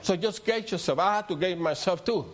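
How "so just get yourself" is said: high, rising preacher's cadence; preaching. Acted. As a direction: preach it out to the congregation. Preaching on dying to the flesh.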